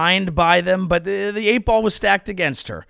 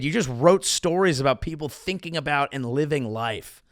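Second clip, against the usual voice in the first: usual speaking voice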